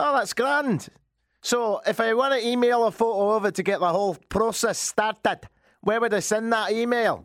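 high, pleased Scottish customer; put-on Scottish accent. Customer asking where to email photos, put-on Scottish accent. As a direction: keep up the hammy Scottish lilt. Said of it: "Scotland's good".

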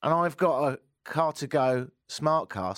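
ordinary talk, nothing marked

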